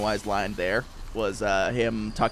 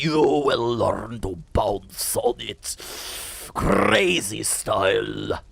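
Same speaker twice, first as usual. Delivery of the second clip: slow, drawn-out vampire count voice; Nosferatu impression; much slower than usual. Mocking Count Orlok meme for chat, slow and eerie. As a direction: creep each word out like Count Orlok. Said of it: "mimicking his voice mannerisms".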